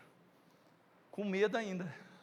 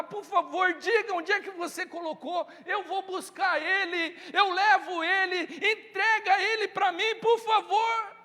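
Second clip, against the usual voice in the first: rising, ever higher begging; desperate pleading; 8 semitones higher, 4 dB louder than usual. Acted. Mary begging the gardener for Jesus, rising plea. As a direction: beg harder with each line, climbing higher. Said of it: "Olha a disposição dessa mulher".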